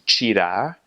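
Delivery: "tirar" opening on "chee"; Brazilian ch sound. Shown as a demonstration. Teacher showing the word for to take, Brazilian pronunciation. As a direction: say "tirar" slowly as chee-RAH. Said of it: "if there's a T I".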